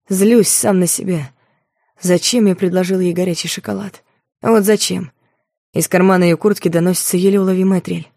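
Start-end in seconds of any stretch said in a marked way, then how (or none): none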